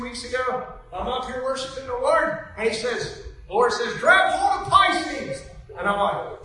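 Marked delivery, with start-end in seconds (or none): 4.0-5.4 loud, high, drawn-out order; retold divine command. The Lord instructing a worshiper, emphatic retold command. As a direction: proclaim it slowly, raised well up.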